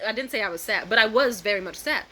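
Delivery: ordinary talk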